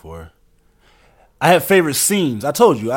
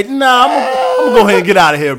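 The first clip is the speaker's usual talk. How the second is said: laughing refusal; 9 semitones higher, 5 dB louder than usual. Real, not acted. Refusing to play his game.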